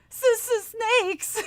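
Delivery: high, shaky stammer of fright; stammering fear. Acted. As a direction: stutter the word in terror. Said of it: "Mike stammered".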